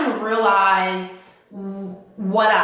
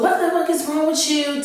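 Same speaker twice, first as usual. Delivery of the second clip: higher, mock furious demand; escalating angry question; 7 semitones higher than usual. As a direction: push it up into a retold rage. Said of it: "it just gets more and more".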